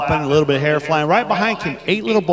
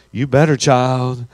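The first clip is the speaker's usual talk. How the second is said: lower, slow mom warning; a hint of a stern mother; 6 semitones lower, 3 dB louder, slower than usual. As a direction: play the no-nonsense mom. Mom answers the teenager.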